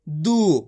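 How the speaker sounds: दू with a long, stretched ऊ; long vowel. Shown as a demonstration. Teacher drawing out दू for the class. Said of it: "खींच के पढ़ेंगे।".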